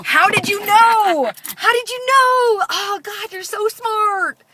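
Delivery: loud, high, drawn-out fake awe; hammed-up exaggerated astonishment; sarcastic mock amazement. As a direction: gush with over-the-top sarcasm. Sarcastic retort to teen gamer.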